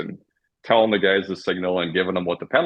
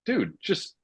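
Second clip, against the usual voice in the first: exasperated ref; 8 semitones higher than usual. Retold or imitated. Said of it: "I'm like".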